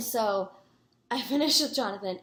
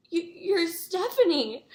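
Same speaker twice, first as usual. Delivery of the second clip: starstruck stammer; 8 semitones higher, 5 dB louder, slower than usual. Retold or imitated. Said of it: "I just go".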